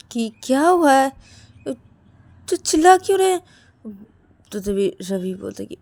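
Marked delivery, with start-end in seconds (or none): 0.1-3.4 raised, loud complaint; annoyed questioning. Acted. Rahul woken by the shouting asks why, annoyed. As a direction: ask loudly, pitched up and bothered.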